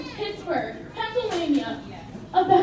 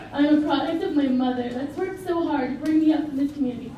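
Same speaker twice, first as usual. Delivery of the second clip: ordinary talk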